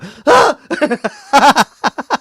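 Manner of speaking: high, loud burst of laughter. Real, not acted. Host laughs at late start.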